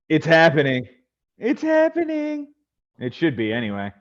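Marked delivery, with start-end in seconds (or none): 0.1-0.9 punchy heads-up to the group; excited announcement. Real, not acted. Host alerting the guys that the draft opens, excited. 1.4-2.5 very high, drawn-out playful repeat; high-pitched excitement. Pretend. Host teasing the draft room's opening, high mock excitement.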